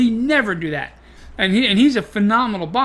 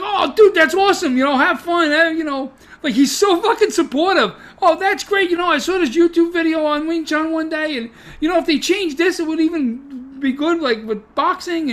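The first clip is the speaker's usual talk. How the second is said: higher, bubbly imitation of an overly encouraging buddy; gushing supportive friend; 8 semitones higher than usual. Coach imitated cheering on a friend's Kung Fu plans, gushing and high. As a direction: play him cheering you on, all upbeat and eager. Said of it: "he'd be like".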